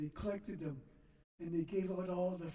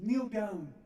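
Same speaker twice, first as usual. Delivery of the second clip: stern command; 3 semitones higher, 7 dB louder than usual. Retold or imitated. Nun orders child to kneel.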